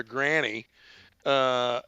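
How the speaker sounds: ordinary talk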